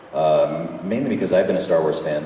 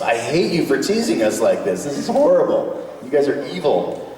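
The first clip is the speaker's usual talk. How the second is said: higher-pitched mock indignation; imitated fan outrage; 7 semitones higher, 3 dB louder than usual. Voicing fans upset at being teased, mock outrage. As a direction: complain like an upset fan, hammed-up. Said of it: "And the other reaction was".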